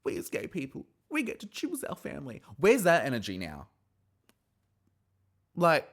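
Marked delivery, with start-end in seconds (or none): 0.0-2.4 mocking preachy quote. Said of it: "It's like".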